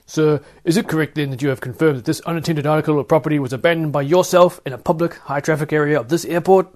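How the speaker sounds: a loud, formal officer grilling the suspect; officer interrogates the narrator about his abandoned sandwich, loud and formal; stern airport officer. Acted. Security officer questioning passenger.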